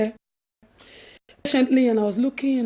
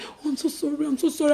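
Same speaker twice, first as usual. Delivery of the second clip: apologetic; 8 dB quieter than usual. Retold or imitated. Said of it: "she was like".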